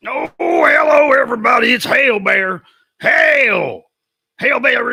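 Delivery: high, loud bear puppet shout; big cartoon bear bellowing hello; puppet bear greeting. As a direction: boom hello as a happy puppet.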